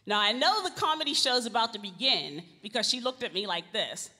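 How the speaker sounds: normal talking voice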